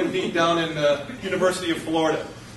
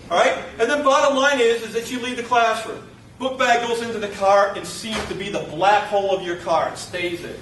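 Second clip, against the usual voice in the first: usual speaking voice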